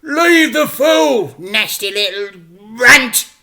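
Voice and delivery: high, loud, slow snarling crooks; angry shouting. Acted. The crooks in the back yell at dad, loud high angry shouts. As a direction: yell with contempt. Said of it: "started shouting".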